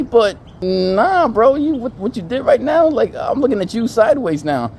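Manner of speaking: high, loud mock indignation; hammed-up appalled objection; disbelieving protest. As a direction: call him out, voice pitched right up.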